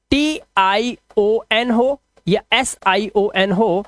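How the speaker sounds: each letter named slowly and clearly; slow spelled-out rule. Shown as a demonstration. Teacher slowly spells out the rule for students. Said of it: "teacher spelling out the rule".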